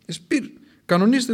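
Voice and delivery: high, pointed warning; stern warning. Acted. John threatening the unrepentant, high and pointed. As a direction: jab it at them as a threat. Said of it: "τους έλεγε".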